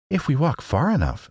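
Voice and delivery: a hint of little Dorothy; girl's voice. A narrator's reading. Dorothy hopeful to her friends, lightly girlish.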